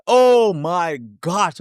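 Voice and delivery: high, slow drawn-out oh my gosh; mock dismay. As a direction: stretch it out in disbelief. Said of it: "I'm like".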